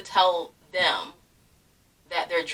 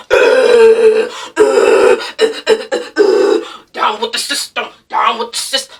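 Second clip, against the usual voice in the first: loud grunting mock punk singer; mock rock screaming; 7 dB louder, slower than usual. Mocking what the teacher thinks rock is, loud grunts. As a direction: ham up a shouty rock chant. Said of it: "a bunch of screaming".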